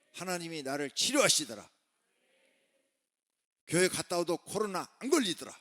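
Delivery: loud, rising proclamation; exultant testimony. Acted. Preacher voicing a believer healed by God, exultant and loud. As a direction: build to a high, forceful shout of testimony.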